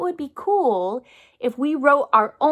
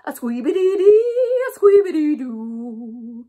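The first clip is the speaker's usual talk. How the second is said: louder playful sax noise; saxophone imitation; 4 dB louder than usual. Shown as a demonstration. Teacher to kids, imitating a saxophone playfully. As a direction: squeal it out like a saxophone. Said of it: "Sounds something like this".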